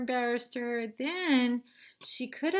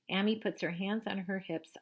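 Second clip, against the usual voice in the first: plain reading voice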